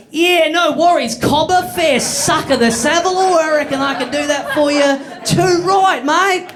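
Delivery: exaggerated Strine, cobber and mate; broad Australian accent. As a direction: lay on the Aussie slang thick. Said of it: "talk in your regular Australian accent".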